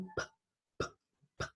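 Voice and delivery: "P" as a voiceless puff, no vowel; isolated P sound. Shown as a demonstration. Teacher demonstrating a voiceless sound, bare P. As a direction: pop the lips on "P" without voice. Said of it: "Solo la P".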